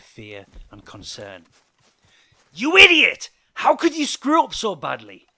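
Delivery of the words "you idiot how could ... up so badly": high, very loud outburst; angry shout. A narrator's reading. Joe blaming Hank over the debt, shouting angrily. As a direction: shout the insult in furious blame. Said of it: "he shouted".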